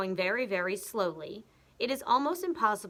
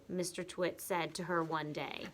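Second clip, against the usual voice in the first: plain reading voice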